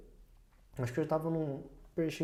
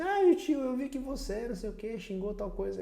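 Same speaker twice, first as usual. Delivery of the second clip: much higher, childlike imitation; child's voice; 9 semitones higher than usual. Child telling tio about his swearing, imitated high voice. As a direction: pipe up like a little kid telling on someone. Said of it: "um dia uma criança falou assim".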